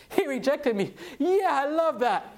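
high, loud sarcastic cheer; hammed-up joy, stretched at the end; mock delight. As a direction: celebrate it as if it were great news. Mock delight at being rejected.

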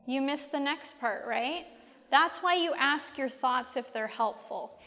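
ordinary talk

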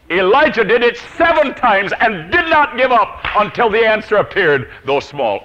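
high, loud preaching; ringing, insistent proclamation; forceful exhortation. Real, not acted. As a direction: drive it home with full pulpit force.

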